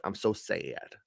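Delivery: quiet, deadpan imitation of Elora in an American accent; mock American accent. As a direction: drone it out in a flat-toned American accent, mocking her. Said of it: "That's how she speaks in an American accent".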